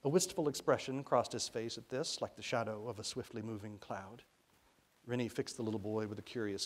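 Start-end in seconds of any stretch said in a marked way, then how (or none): none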